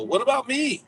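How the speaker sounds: high, playful whine; teasing demand for praise; mock jealous. Jokingly wants compliments too.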